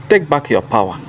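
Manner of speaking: ordinary delivery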